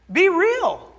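high, loud, punched command; emphatic shout. Real, not acted. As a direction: call it out to the church. Pastor urging church to live faith.

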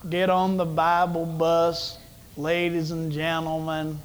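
put-on low, slow radio-preacher accent; slow, low-pitched old-time radio Bible teacher's accent; J. Vernon McGee. Preacher imitating a radio Bible teacher for his congregation, drawling accent. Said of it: "with his accent of".

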